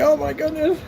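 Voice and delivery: high-pitched mock whine; mock crying. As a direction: imitate a crybaby naysayer. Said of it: "he started crying".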